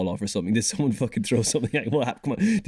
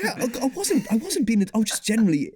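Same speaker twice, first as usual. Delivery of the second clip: laughing protest; 11 semitones higher than usual. Real, not acted. Laughing protest about the spat.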